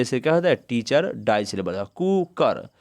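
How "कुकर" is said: Cooker in two drawn-out parts, कु and कर; cooker carefully divided in two; two beats. Shown as a demonstration. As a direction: say cooker as कू-कर, each syllable its own beat. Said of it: "दो बार बोलते हैं".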